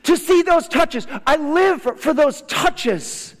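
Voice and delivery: high, loud, full-force preacher's cry; impassioned preaching. Real, not acted.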